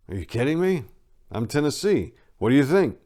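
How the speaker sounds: cocky boast. Acted. Cocky reply.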